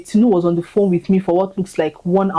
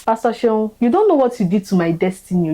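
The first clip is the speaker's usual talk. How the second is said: usual speaking voice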